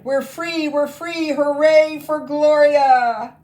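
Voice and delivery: high, loud cheering; freed goblins cheering for Gloria; joyful cry. Acted. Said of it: "the witches and goblins cried".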